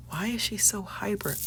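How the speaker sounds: unvoiced whisper of a viewer's thought; mock-puzzled whisper; whispered imagined viewer. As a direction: whisper the onlooker's question. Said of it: "somebody here is probably wondering".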